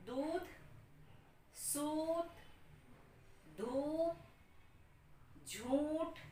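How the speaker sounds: each word read alone, long ऊ held; careful word list with long vowels. Shown as a demonstration. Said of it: "जिसमें बड़ी ध्वनि लंबी आती है".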